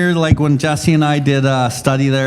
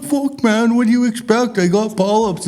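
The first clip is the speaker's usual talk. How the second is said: pitched-up mock retort from the buddy; imitating his buddy; 7 semitones higher than usual. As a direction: play the buddy snapping back, higher. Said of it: "He's like".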